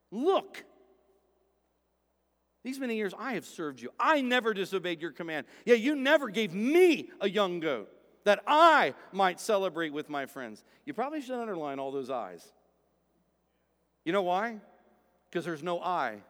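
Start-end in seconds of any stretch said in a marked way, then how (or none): 0.1-10.6 mock resentful complaint, Look drawn up; a son whining his grievance to his father; resentful elder son. Elder son complaining to his father, in mock resentment. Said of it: "And there's tone there".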